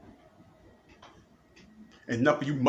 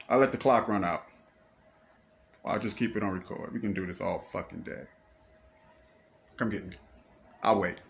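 much softer and lower, a calm dare; quiet taunt; 7 semitones lower, 7 dB quieter than usual. Real, not acted. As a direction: say the dare low and unhurried. Taunting the watchers after shouting.

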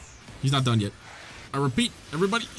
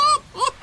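high burst of laughter. Real, not acted. Laughs after yelling stop.

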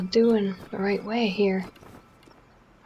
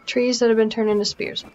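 usual speaking voice

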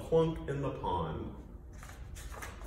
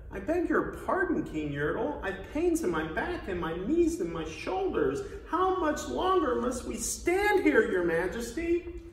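higher-pitched, pleading underling; meek little turtle; 8 semitones higher than usual. Acted. Little turtle begs the king for relief, meekly. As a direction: plead politely upward in a small high voice. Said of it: "this plain little turtle looked up and he said".

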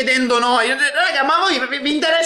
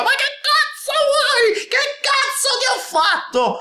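high, slow mocking whine; mocking parody of the girl; 13 semitones higher, slower than usual. Mocking the girl from the clip in a high, slow whine. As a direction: ham it up like the angry girl. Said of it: "fare parodie".

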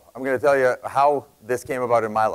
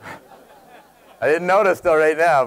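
laughing realization; 5 semitones higher, 5 dB louder, much slower than usual. Retold or imitated. Laughing realization of fancy office.

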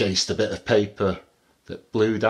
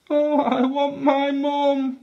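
put-on high, slow baby elephant wail; acted plaintive cry, stretched out; 14 semitones higher, 4 dB louder, slower than usual. A narrator's reading. Baby elephant frightened by a monster, high wail. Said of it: "wailed the baby elephant".

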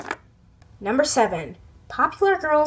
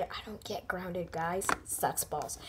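much softer muttered complaint; muttered aside; 7 semitones lower, 15 dB quieter than usual. Real, not acted. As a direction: grumble to the audience under the breath. Aside to viewers.

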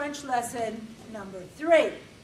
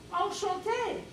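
much higher, coy Enchanté; flirty enchanté; 8 semitones higher than usual. Retold or imitated. Greeting Maurice at the bar, much higher and coy. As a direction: lift it up high and coy.

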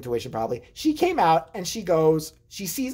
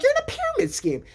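exasperated disbelief. Retold or imitated. Calling out brother.

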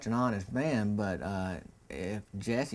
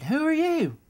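put-on much higher and louder, a befuddled old man; confused old man; 8 dB louder than usual. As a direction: demand it high and loud like a confused old man. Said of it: "he looked at me and said".

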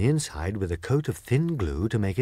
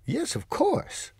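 Oz the wizard; 4 dB quieter, slower than usual. Acted. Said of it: "replied Oz".

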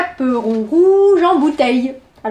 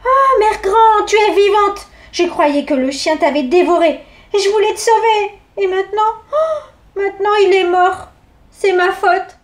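higher, tearful-sounding relief; a girl glad then guilty; relieved little girl; 6 semitones higher than usual. Acted. Girl to her granny, higher and anxious.

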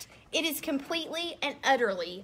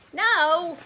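firm refusal; 6 semitones higher, 7 dB louder than usual. Acted. Book answers its reader.

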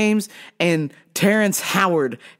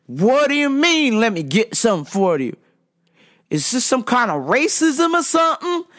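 high mock indignation; aggressive customer; 7 semitones higher than usual. Customer accusing the cashier of racism, aggressively. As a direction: imitate an outraged, accusing man. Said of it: "the attitude is very aggressive".

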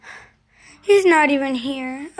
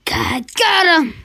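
ordinary talk

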